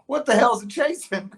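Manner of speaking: high, loud, amused mock puzzlement; imitated onlookers. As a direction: play baffled friends watching a chase. Voices bandmates, amid laughter.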